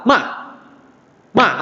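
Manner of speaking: "ma" falling sharply from high; short falling Mandarin fourth-tone "ma". Shown as a demonstration. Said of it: "nada keempat adalah".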